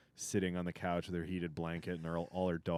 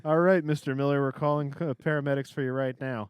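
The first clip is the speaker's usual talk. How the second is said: dispatcher voice; 5 semitones higher, 7 dB louder than usual. Retold or imitated. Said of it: "I'm calling paramedics".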